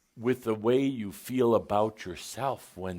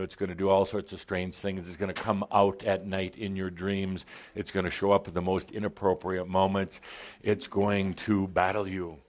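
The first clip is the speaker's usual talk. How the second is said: ordinary talk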